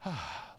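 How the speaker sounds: soft, breathy sigh of relief; everyone's hushed exhale as tension lifts; relieved sigh. Acted. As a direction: let out a quiet "ah" of relief. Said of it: "everybody goes".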